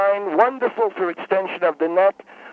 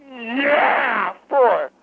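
crying out through the pain; 3 semitones higher than usual. Acted. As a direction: push out the count with a cry. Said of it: "It sounds painful".

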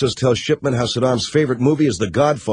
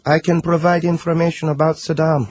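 higher-pitched mock Middle Eastern accent; hammed-up Middle Eastern informant; 4 semitones higher than usual. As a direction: put on a high Middle Eastern accent, trading secrets. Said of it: "I'm doing the accent".

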